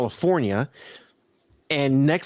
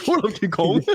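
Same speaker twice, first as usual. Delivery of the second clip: mock yell; 5 semitones higher than usual. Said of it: "I'm over here yelling at him".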